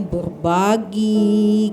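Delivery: usual speaking voice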